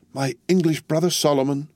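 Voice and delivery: a touch of mourning. A narrator's reading. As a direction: lament his name. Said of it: "mourned Miss Pross".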